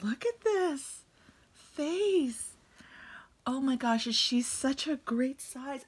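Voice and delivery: high, loud, delighted exclaiming; thrilled gushing. Real, not acted. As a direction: burst out in loud admiration. Showing off the doll's face.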